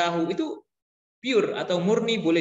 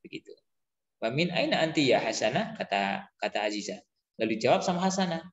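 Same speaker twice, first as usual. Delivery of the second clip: ordinary talk, nothing marked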